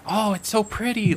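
put-on high, wide-eyed little kid; child voice. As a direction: coo over the flowers like a child. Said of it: "is like".

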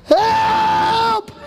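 long, high, loud cries for help; high-pitched mock-panic scream. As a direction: scream it out as if on a runaway ride. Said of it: "I'm still screaming".

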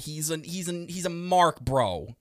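loud, cocky bro know-it-all rant; hammed-up loud bro ranting about Tony Khan; mock bro fan. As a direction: puff up like a fan who thinks he could book better.

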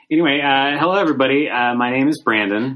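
upbeat, loud show opener; podcast host intro. Acted. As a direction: greet the audience brightly.

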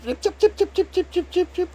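high, rapid "tip tip tip" noise; imitated flapping noise. Telling how the little dwarf flapped her arms, high imitated flapping noise. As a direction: make quick high-pitched ticking flutters.

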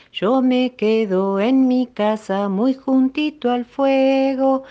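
high, slow, sing-song rhyme; sung-song poem recital. Acted. Reading a rain poem to children, slow and sing-song.

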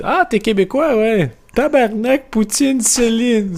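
voix plus aiguë, enjouée et niaise; imitation d'un Français. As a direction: singer le touriste qui aligne les clichés. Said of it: "qui dit". (un Français énumère les clichés au Québécois, ton aigu et ravi)

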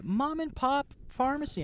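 high, slow mocking read; mocking sign voice. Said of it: "they're all like".

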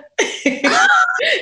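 high burst of real laughter. Real, not acted. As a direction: let out a sudden high laugh.

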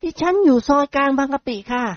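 put-on sweet polite young lady; young woman voice. Model gives address. (เสียงหญิงสาวสุภาพอ่อนหวาน)

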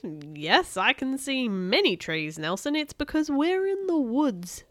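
slow, exaggerated patience; drawn-out mocking explanation, pitch climbing at the end; sarcastic. A narrator's reading. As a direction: spell out the obvious to a dim friend. Friend sarcastically states the obvious.

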